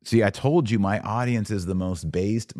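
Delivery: ordinary talk, nothing marked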